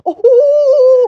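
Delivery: high, loud, drawn-out ohs; excited alarm. Acted. Vlogger suddenly spots a way out, excited cries. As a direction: stretch each cry out loudly. Vlogger in panic has idea.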